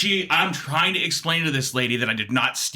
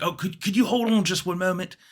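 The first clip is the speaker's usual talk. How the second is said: put-on higher, fussy British old man; Britishy accent; 5 semitones higher than usual. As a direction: stammer politely as a British caller. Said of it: "Britishy accent".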